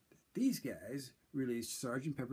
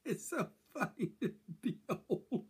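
higher, slow laughing through the words; 13 semitones higher, 4 dB louder, slower than usual. Real, not acted. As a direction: laugh at finding the glasses in hand. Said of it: "They're my glasses".

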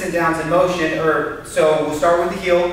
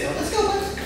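high coaxing call; 5 dB quieter than usual. Real, not acted. Trainer urging dog to walk.

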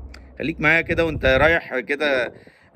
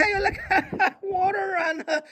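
much higher, squealing laughter through the words; mocking laughter; 3 dB quieter than usual. Mocker ridiculing someone asking for water, mocking laughter. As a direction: laugh helplessly at the fancy pronunciation.

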